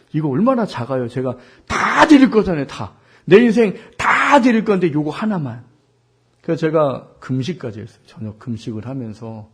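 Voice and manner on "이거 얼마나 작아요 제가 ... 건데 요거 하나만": 크고 높게 애원하듯 힘줘 조르기; '다'를 크게 끌어올리며 하나님께 매달리듯; 간절한 기도 재연. Retold or imitated. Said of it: "정말 그렇게 기도했어요". (간절히 떼쓰는 기도 흉내)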